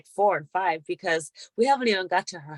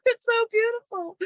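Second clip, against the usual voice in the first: very high, overcome imitation of tears of joy; mock happy crying. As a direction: gush it out like you're crying from happiness. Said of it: "breakdown crying in this happy moment".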